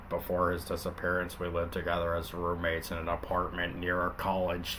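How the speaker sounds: lightly voiced hard-boiled cop; Linkara cop voice. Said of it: "I'll just do Linkara".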